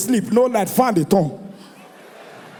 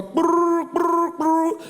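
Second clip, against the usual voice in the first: rolled lips buzzing like a ringing phone; phone ring imitation; 6 semitones higher, much slower than usual. Imitating the phone ringing for the husband to hear. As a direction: mimic the phone vibrating, brrr brrr.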